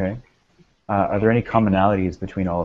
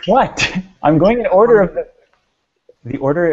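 high, loud startled protest; indignant surprise; 10 semitones higher, 7 dB louder than usual. Real, not acted. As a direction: jump up in pitch, defending yourself. Mock protest at being laughed at.

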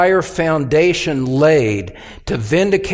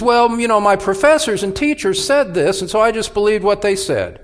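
higher-pitched, quick imitated excuse; mock self-justifying student, pitched up; excuse-maker; 4 semitones higher than usual. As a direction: act out someone lightly explaining themselves away. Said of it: "It's not going to be an excuse as".

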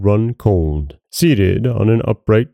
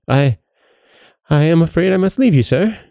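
high, hurried, faltering excuse; fearful effort; 9 semitones higher than usual. A narrator's reading. Sexton tries to leave the goblin, frightened. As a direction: stammer once, then rush to get away. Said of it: "making an effort to move".

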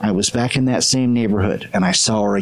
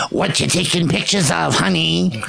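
raised, imitated New Jersey twang of an older lady; New Jersey accent; 5 semitones higher than usual. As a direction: lightly put on New Jersey accent, calling across the street. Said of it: "real pronounced New Jersey accent".

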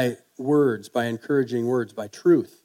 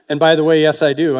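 louder, punchy yes I do; loud playful confession; loud emphatic aside; 2 semitones higher, 6 dB louder, faster than usual. Real, not acted. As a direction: throw it out as a proud joke. Preacher's aside joke about himself.

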